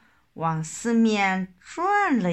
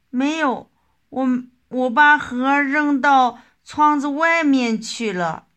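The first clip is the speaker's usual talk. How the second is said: mildly stammered, higher-pitched protest; stammering; 6 semitones higher, 6 dB louder than usual. A narrator's reading. Frightened child answering mother about the swallowed pit, stammering. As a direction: blurt it out louder, tripping over the first words. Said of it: "结结巴巴地说".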